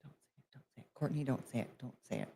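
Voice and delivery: very soft, low muttering to oneself; barely audible, holding yourself back; muttered self-restraint. Real, not acted. Warning herself under her breath.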